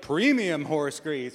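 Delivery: mock gift-giver insisting it's premium; proud emphasis. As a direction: lean proudly on the word Premium, said slowly. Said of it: "the person's like".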